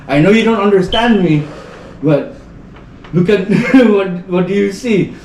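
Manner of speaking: put-on high, gentle voice for a baby; talking to a baby. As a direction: coax the baby to answer.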